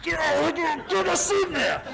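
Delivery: high laughing yell of the order; retold shout. Actor retelling shouting on set, laughing yell. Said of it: "'cause I go".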